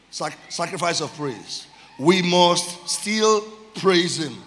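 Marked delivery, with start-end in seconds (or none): 2.0-4.3 very slow, words set apart; slow declaration. Real, not acted. Preacher announcing final praise point, slow weighty declaration. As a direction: hold each word for weight. Slow repeated title phrase.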